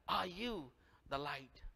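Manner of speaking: normal talking voice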